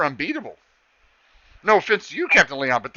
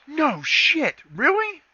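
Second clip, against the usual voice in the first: much higher, surprised sarcastic squeak; mock amazed girl; 11 semitones higher, much slower than usual. The girl scoffs at Max in a high, amazed squeak. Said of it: "She goes".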